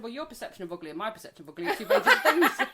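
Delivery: very fast, laughing through it; rapid laughing retort. Retold or imitated. Telling the salesman off, fast and laughing. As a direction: rattle it off with a laugh.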